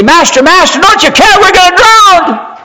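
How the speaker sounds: high, frantic plea for help; panicked cry. Retold or imitated. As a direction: cry out like men about to drown. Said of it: "they wake him up and say".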